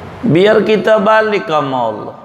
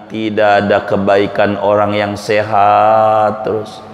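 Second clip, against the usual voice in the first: ordinary talk, nothing marked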